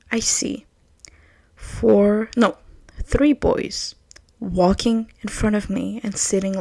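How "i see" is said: hushed, breathy storytelling whisper. Acted. Telling the study story, a whisper. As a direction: drop to a secretive whisper. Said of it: "whisper heard".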